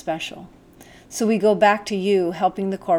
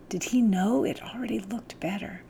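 whispered thought; 3 semitones higher, 5 dB quieter than usual. A narrator's reading. Narrator's private wondering.

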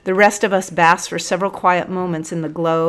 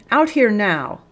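higher-pitched, contained roar of command; sergeant's roar; 4 semitones higher, 4 dB louder than usual. A narrator's reading. Sergeant ordering Busuku out, a mild roar. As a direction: order him out sternly. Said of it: "roared the sergeant".

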